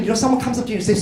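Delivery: usual speaking voice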